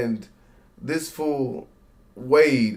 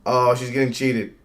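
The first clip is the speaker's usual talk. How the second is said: normal talking voice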